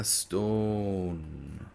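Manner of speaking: usual speaking voice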